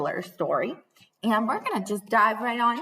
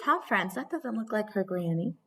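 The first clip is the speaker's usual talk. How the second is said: plain reading voice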